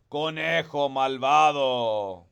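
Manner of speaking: loud, slow Spanish curse; slow angry curse. Acted. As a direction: drag it out like a furious coyote. Coyote cursing rabbit again.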